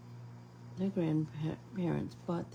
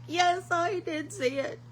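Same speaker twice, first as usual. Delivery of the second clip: very high, laughing and louder; mock-bright answer, much higher and louder; high-pitched laughing reply; 13 semitones higher, 5 dB louder than usual. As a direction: squeak it out through a laugh. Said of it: "I was like".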